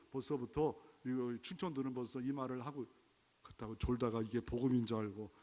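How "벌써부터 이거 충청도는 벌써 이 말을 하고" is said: usual speaking voice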